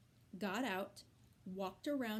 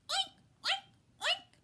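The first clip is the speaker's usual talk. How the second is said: high squealing oinks; boy imitating a pig; piggy oinks; 7 dB louder than usual. A narrator's reading. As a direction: squeal like a playful piglet. Avery oinking as a pig.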